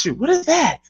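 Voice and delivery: high, rising mock alarm; imitating John. Retelling a bar host spotting a raccoon, mock alarm. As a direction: sound startled and squeaky. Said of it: "when John was like".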